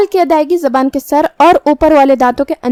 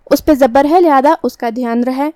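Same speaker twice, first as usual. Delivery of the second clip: ordinary talk, nothing marked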